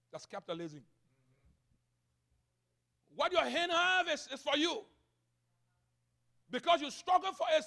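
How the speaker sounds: high, emphatic pulpit delivery; forceful preaching. Real, not acted. Pastor voicing capitalist thinking to church, forceful preaching. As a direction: build to a loud possessive declaration.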